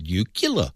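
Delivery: "nuclear" said slowly as NEW-kyuh-ler; mock-correct nuclear. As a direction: turn "nuclear" into nucular, as if correcting. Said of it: "it's pronounced nuclear".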